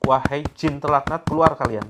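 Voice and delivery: loud command. Shown as a demonstration. Healer orders the jinn to leave the body, loud command. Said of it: "memerintahkan jin ini untuk keluar".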